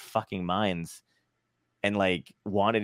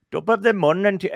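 put-on high, loud mangled Irish accent; a bad Irish accent that misses; botched Irish accent; 9 semitones higher, 6 dB louder than usual. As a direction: imitate a friend failing an Irish accent. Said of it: "he'd be like".

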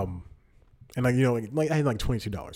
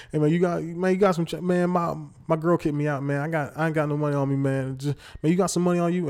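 higher, pleading street guy asking for cash; begging stranger voice; 5 semitones higher than usual. Retold or imitated. Stranger in parking lot begs the comedian, pitched-up pleading. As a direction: play the broke guy hustling for change, pitched up.

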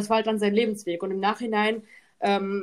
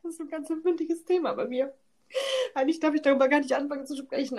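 much higher, real tearful voice; crying; 5 semitones higher than usual. Real, not acted. Explaining to her friend why grandpa's death makes her cry. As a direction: speak through the tears, voice breaking. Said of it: "oh, jetzt muss ich gleich weinen".

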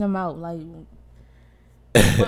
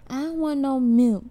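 put-on higher, louder childish complaint; whiny kid voice; 7 semitones higher, 4 dB louder than usual. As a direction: mimic a fussy toddler. Mom imitating her kids.

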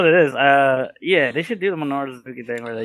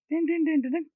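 bell ding imitation; 13 semitones higher, 5 dB quieter than usual.

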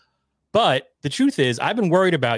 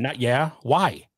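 slow, insistent why; pressing Ray for a real answer, slow and insistent; slower than usual. Real, not acted. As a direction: stretch why, demand an answer.

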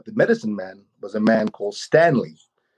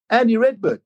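much higher, lightly voiced mother; voicing Mum; 11 semitones higher than usual. Mum telling her son who the visitor is, higher voiced. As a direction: pitch way up for Mum's matter-of-fact answer.

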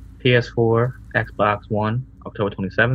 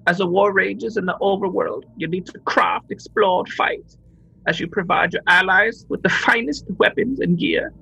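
high, hammed-up Guyanese accent of the boss; imitating boss in Guyanese accent; Guyanese accent impression; 10 semitones higher than usual. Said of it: "That's a Guyanese accent for you".

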